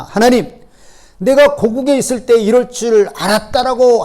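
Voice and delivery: indignant prophet's outburst; angry complaint. Acted. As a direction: throw the grievance up at God. Jonah's angry complaint quoted.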